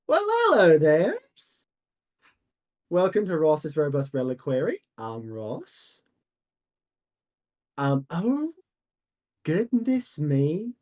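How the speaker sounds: lightly voiced jolly shopkeeper, welcoming; a hint of a folksy old shop owner. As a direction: greet the old customer like a cheery merchant.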